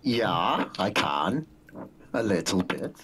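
low German-accented reply; lightly put-on German accent. Shown as a demonstration. Streamer answering a request for it, German accent. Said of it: "would you do a German accent for us".

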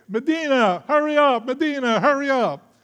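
loud, high yell of the name; yelling boss. Retold or imitated. Boss yelling at the young worker to hurry, loud and high. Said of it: "every day, all day".

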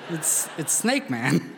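laughing. Real, not acted. Laughing answer to sheriff's question.

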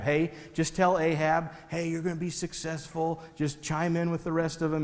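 softly coaching someone on what to say. Acted. Messenger to prophet on the way to the king, quietly coaching. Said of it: "He's, like, coaching this prophet".

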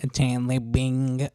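slow, drawn-out Oh my God; Janice voice. Retold or imitated. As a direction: stretch it out like Janice from Friends. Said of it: "A los Janis".

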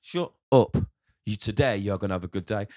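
shouted shut up, then a firm pep talk; loud self-pep talk. Retold or imitated. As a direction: bark it down, then tell yourself firmly. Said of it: "the one in the middle's like".

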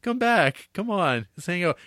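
high, coaxing calls to a dog; pleading owner. Retold or imitated. Umberto calling Flake back in the park, high and pleading. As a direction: call the runaway dog back, pitch up high. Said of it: "It's like".

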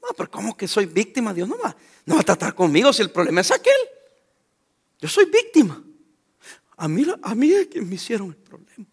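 mock self-pitying complainer, lightly voiced; a hint of a whining churchgoer; whiny victim. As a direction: play the wronged victim, half-hammed. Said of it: "juega el papel de víctima".

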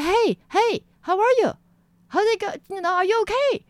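high, loud calls of concern; retold alarmed check-in, pitched high; urgent calling out. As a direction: call out to rouse someone dazed. Calling to dazed, bleeding friend.

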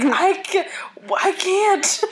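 high, whiny half-crying plea; scared whimper. Retold or imitated. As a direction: whimper like you're stuck. Friend voiced, scared on bridge.

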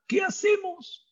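high, pleading cry of panic; the trapped people crying out in mock panic; panicked cry. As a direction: wail it like a trapped crowd. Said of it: "empezaron a clamar".

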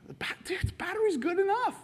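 high, light, whispered-then-voiced refusal; satisfied with the little gift; content refusal. Receiver telling the giver the battery is enough, high and light.

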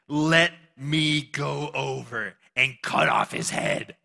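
slow, eager warrior asking leave, lightly acted; drawn-out hothead offering to kill; a hint of a fierce soldier's demand; lightly voiced demand.

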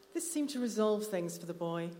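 usual speaking voice

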